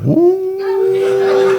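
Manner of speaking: long, high "ooh"; excited ooh. Retold or imitated. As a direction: hoot with rising anticipation. Mock excitement at Katy's question.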